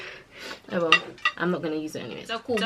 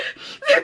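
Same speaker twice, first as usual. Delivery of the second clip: high laugh. Real, not acted. Laughs mid crying reenactment.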